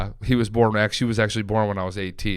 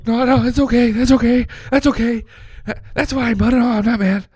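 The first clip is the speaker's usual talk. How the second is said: high, loud, strained reassurance; overdone high-pitched reassuring; hasty reassurance; 12 semitones higher, 5 dB louder than usual. Retold or imitated. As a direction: imitate a dad insisting too hard that he's fine. Dad hastily reassuring his son.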